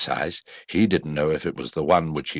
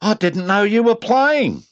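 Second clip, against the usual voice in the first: very high, louder, slow; Roo's voice; 6 dB louder than usual. A narrator's reading. Roo asking Eeyore, high innocent voice. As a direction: ask innocently like a small child. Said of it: "said Roo".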